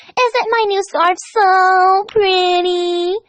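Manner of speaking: high, very slow posh drawl; Rarity impression. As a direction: preen like a fancy pony. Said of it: "I'm Rarity".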